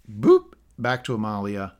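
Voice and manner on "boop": a bright high boop; high boop effect. Real, not acted. Host marking a speaker switch, high boop. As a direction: beep for the switch.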